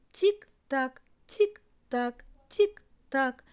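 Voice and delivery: voiced clock tick-tock. A narrator's reading. Narrator mimics the clock ticking in the castle.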